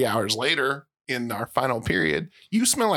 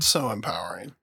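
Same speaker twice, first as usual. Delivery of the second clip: sarcastic; 6 semitones lower than usual. Real, not acted. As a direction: say it deadpan, mocking the praise. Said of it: "I feel like you're being sarcastic".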